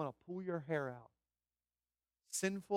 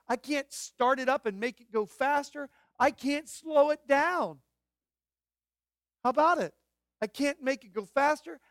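high, loud sermon cry; impassioned preaching; 8 semitones higher, 9 dB louder than usual. Real, not acted. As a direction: preach it at full force, drawing out key words. Preaches at full force.

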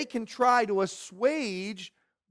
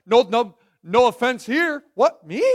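hammed-up "no offense taken" act; mock nonchalance; 3 semitones higher, 4 dB louder than usual. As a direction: insist far too cheerfully that nothing is wrong. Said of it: "Like most of us do".

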